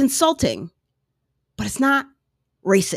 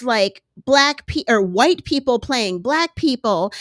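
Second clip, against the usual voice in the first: mock protest; 5 semitones higher, 3 dB louder than usual. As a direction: drag out an aggrieved objection, mockingly. Voices people complaining it's unfair.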